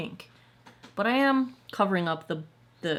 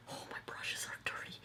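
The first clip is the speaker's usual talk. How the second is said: whispered aside; 16 dB quieter than usual. Real, not acted. Aside noticing her dirty brushes.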